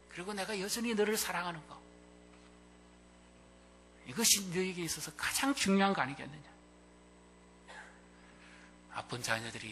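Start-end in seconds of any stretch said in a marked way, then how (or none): 0.1-6.5 higher and fervent, 너를 stressed; fervent declaration. Acted. Jesus fervently assuring Peter of love. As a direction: proclaim the love with force.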